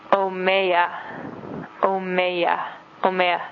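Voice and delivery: omeya said slowly, oh-meh-yah, three times over; teaching the word omeya to newcomers, carefully pronounced; Oshindonga word omeya. Shown as a demonstration. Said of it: "water is".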